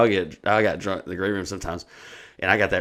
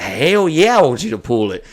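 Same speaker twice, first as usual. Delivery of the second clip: loud, high cocky challenge; eager dare; 6 semitones higher, 7 dB louder than usual. Retold or imitated. Drunk comic to the man reaching under shirt, eager dare. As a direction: egg him on, loud and high at the start.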